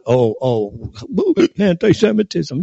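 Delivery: high, mocking whiny voice; mock whine. Host mocking a congressman's complaint in a high whine. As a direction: whine it like a mocked crybaby. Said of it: "whining about".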